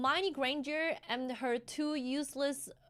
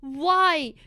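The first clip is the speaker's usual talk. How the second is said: exasperated; 4 semitones higher, 8 dB louder than usual. Retold or imitated. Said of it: "And I'm just like".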